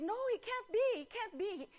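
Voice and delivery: high pleading denial; disbelief. Retold or imitated. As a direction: voice the stunned fan's denial. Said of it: "someone was interviewed".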